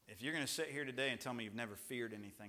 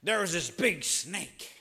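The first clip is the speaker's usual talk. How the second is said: put-on high, loud little-boy voice, slow; imitating his son; 6 semitones higher, 17 dB louder, slower than usual. Son telling dad about the snake, high excited kid voice. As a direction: play an excited small boy. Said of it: "and he's like".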